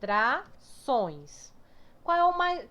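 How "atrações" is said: ATRAÇÕES in slow, separate syllables with gaps; very slow dictation of ATRAÇÕES, piece by piece; divided into syllables. Shown as a demonstration. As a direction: pause between each syllable of Atrações. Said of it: "Como é que divide atrações?".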